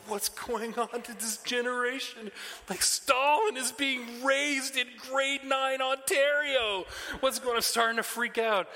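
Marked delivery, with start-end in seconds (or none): 0.1-7.6 high, loud hammed-up panic; mock panic. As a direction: play the dad freaking out over the kids. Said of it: "I'm like".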